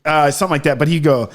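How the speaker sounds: usual speaking voice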